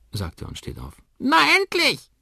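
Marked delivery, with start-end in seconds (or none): 1.2-2.1 schrilles, sehr hohes Papageienkrächzen; krächz es wie ein Vogel; krächzender Kakadu. A narrator's reading. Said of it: "krächzt Oskar". (Kakadu krächzt laut und hoch)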